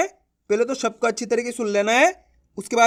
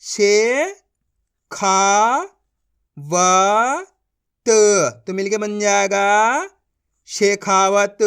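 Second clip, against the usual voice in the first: slow spelled-out reading; 5 dB louder, much slower than usual. Shown as a demonstration. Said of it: "चलिए एक बार फिर से देखिए".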